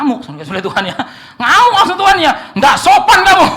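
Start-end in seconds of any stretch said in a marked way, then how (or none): 1.4-3.5 roaring high and loud at the guest; furious shout. Acted. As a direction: bellow the rebuke in outrage. Angry patron scolds the client. (teriakan marah)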